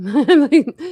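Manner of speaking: loud embarrassed laugh. Real, not acted. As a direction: burst out laughing. Laughs at own mishap.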